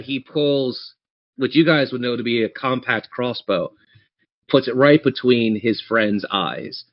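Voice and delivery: ordinary delivery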